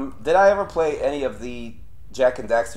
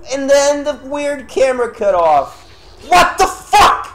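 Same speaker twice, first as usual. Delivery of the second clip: high, loud outburst at the game; real exasperated shouting; frustrated yelling; 14 semitones higher, 10 dB louder than usual. Real, not acted. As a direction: yell it in high-pitched outrage. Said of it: "I'm sorry for being so loud".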